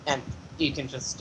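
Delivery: normal talking voice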